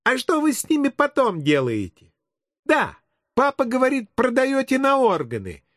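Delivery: put-on high-pitched kid voice; inquisitive child. Child quizzing the policeman about arrests, high kid voice. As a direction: pipe up like a nosy preschooler.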